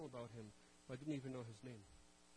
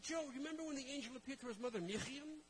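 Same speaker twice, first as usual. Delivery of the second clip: quick, high teacherly reminder; patient correction; 8 semitones higher than usual. Retold or imitated. Guide reminding Joe of the angel and Miriam, patient. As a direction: gently set him straight. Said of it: "He said".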